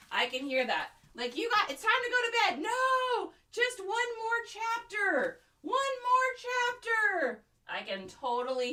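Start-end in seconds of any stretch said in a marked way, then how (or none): 1.4-2.6 mock parent. Acts out bedtime exchange. 2.6-7.4 high, drawn-out whine; hammed-up bedtime pleading; pleading child.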